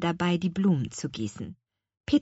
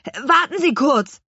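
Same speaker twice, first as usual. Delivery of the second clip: louder, higher eager call; boy calling up; 7 semitones higher, 7 dB louder than usual. Acted. Boy shouting up to old woman, loud eager. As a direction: call up quickly.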